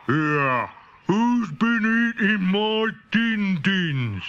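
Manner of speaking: slow, stretched-out daddy dinosaur voice; a big dad dinosaur grumbling about his empty bowl. A narrator's reading. Daddy dinosaur asks who ate his din-dins, slow and grumbling. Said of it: "The daddy dinosaur said".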